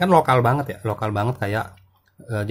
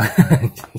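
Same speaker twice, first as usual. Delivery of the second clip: short laugh; 3 semitones higher, 8 dB louder than usual. Real, not acted. Laughing at own Sundanese reading.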